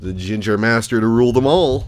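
booming epic announcement; grand announcer. Acted. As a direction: proclaim it like a movie trailer. Grandly announcing his character.

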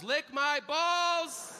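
high, drawn-out mock cry; mock Tourette's outburst. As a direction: stretch it out high, an outburst in church. Kid's outburst during church service.

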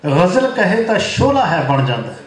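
lilting mushaira verse, phrase by phrase; poem recitation. Acted. Poet praising his friend to the gathering, lilting recitation. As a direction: repeat the opening line, settle onto the refrain.

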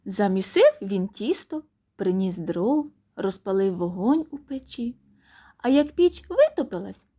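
ordinary delivery, nothing marked

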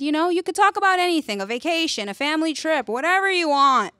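high, fast, breezy teacher voice; casual teacher. Retold or imitated. English teacher assigning the essay, light breezy voice. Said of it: "The English teacher casually said".

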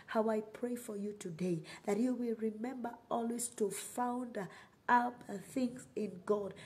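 usual speaking voice

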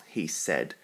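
plain reading voice

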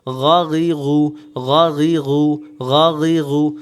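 গাগিগু slow and loud with gargled gh; gargling sound. Shown as a demonstration. Teacher demonstrating that sound, loud gargled gh. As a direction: push out each gargled syllable of গাগিগু. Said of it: "এই যে, যে শব্দ".

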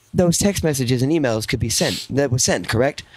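ordinary talk

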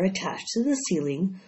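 plain reading voice

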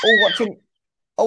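ordinary talk

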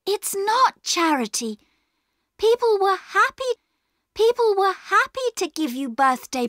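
put-on high child's voice, urgently explaining; high-pitched, insistent young girl; earnest pleading girl. A narrator's reading. Plays Bobby pleading with Perks.

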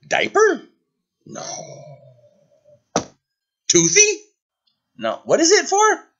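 high-pitched playful questions; high silly guessing. Pretend.

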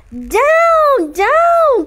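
urgent high shout to bring the broom down; witch's cry. Acted. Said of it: "cried the witch".

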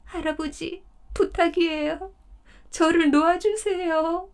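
high, imploring deer voice, begging grandfather; pleading deer. Acted. 덫에 걸린 사슴이 할아버지에게 애원하듯. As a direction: beg him to let you go.

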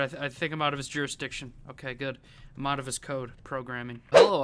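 usual speaking voice

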